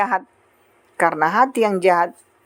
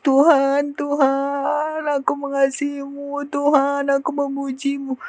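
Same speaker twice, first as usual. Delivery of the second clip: high, drawn-out monotone wail, imitated; mock tearful worship; 6 semitones higher, 3 dB quieter, slower than usual. Churchgoer worshipping to the point of tears, imitated wailing. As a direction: imitate an overwrought worshipper crooning to God. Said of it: "sampai nangis-nangis".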